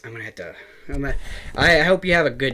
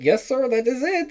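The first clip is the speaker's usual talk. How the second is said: much higher mock Indian caller accent; Indian scam caller accent; 12 semitones higher than usual. Retelling a scam call, mocking the Indian caller in a higher accent. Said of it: "like, an Indian guy".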